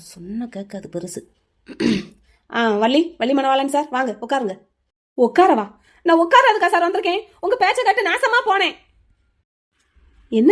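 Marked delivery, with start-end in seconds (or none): 0.1-1.2 slow, low grumble under the breath; quiet, low-pitched exasperated aside; muttered aside. Acted. Grumbling aside about the old man. 6.1-8.8 raised-pitch, aggrieved outburst; indignant, high-pitched accusation; angry complaint. Acted. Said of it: "எதுக்கு கோவமா இருக்கீங்க".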